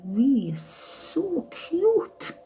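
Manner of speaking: high, slow cooing to a pet. Real, not acted. Owner cooing at her dog in high slow baby talk. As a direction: stretch it out sweetly for the dog. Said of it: "I talk like this".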